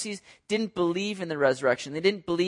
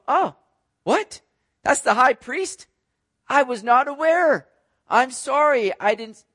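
taken aback; 7 semitones higher, 5 dB louder, slower than usual. Retold or imitated. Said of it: "Paul's sitting here going".

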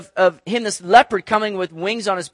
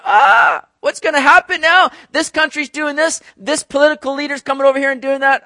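higher, louder anxious cry about the news; panicked worrier; 8 semitones higher, 7 dB louder than usual. Retold or imitated. Preacher mimics anxious people about world events, higher and louder. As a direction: mimic a worried believer, pitched up and loud. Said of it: "we might wonder".

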